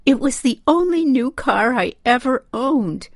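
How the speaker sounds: high, tearful lament; distraught mother's high voice; crying out. A narrator's reading. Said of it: "she cried".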